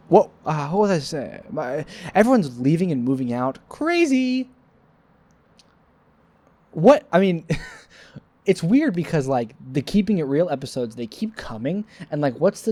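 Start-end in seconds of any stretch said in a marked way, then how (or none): 3.7-7.1 much higher comic "Crazy"; high silly exclamation; high squeak. Acted.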